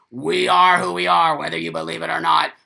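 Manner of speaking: loud defiant shout; loud, confrontational snarl of defiance; aggressive attitude. Pretend. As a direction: bash in yelling at them. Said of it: "come in with an attitude like that".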